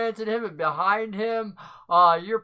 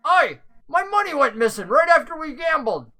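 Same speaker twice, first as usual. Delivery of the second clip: raised, louder indignant complaint; higher, louder accusing NPC; angry accusation; 7 semitones higher, 7 dB louder than usual. Acted. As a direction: call him out over missing money. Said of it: "he was pretty angry about the fact".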